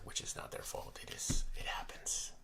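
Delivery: hushed confiding whisper; soft whisper to the viewers; whispered aside. Real, not acted. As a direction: drop to a whisper as if sharing a secret. Quiet aside about printing fault.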